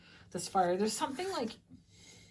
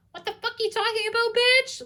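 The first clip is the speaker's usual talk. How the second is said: fast, much higher squeaky indignant retort; mock outraged retort; 4 dB louder than usual. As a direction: fire it back fast and high, playfully outraged. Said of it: "You're just being like".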